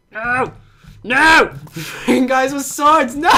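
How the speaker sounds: high, loud exasperated laugh; high laughing groan at being killed; laughing frustration. Real, not acted. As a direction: laugh through the annoyance.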